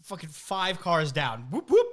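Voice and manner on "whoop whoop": high, loud whoop whoop siren; imitated police siren. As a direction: mimic a cop siren burst.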